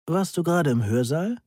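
astonished question. A narrator's reading. Lecturer to a student who confronts him, mildly astonished. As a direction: ask it with a touch of surprise, pitch lifted. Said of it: "fragte Johansen erstaunt".

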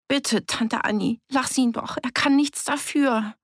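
put-on high, urgent child's plea; pleading child. A narrator's reading. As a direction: beg the aunt to let him go. Child steps in, pleading for Willi.

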